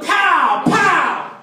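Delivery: high, punchy imitated shout; retold yell. Boat crew ordering him to run, imitated high yelling. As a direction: yell it like a crew's command. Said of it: "And they're yelling".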